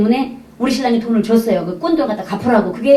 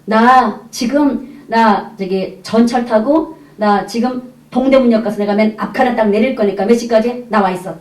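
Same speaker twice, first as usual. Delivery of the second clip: evasive reply; slower than usual. Retold or imitated. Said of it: "동문 서답을 했어요".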